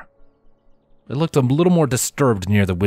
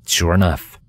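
ordinary delivery, nothing marked

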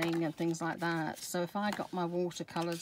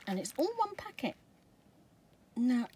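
normal talking voice

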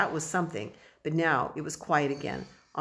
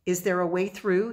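ordinary delivery, nothing marked

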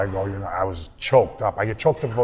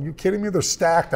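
higher, mock disbelief; incredulous; 7 semitones higher than usual. Young draftee scoffing at home team, higher incredulous. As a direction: scoff at it, pitched up. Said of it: "I'm going".

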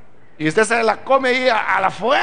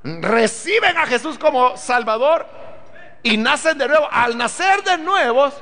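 slow, ringing pulpit proclamation, every phrase hammered home; preaching at full force; 4 semitones higher, slower than usual. Acted. Preacher to his congregation about the new creation, full-force preaching.

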